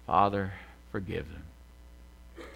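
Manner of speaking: low, soft and very slow; sighed prayer. Retold or imitated. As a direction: sigh it out, drawn out and spent, imitating a dying plea. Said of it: "he sighed".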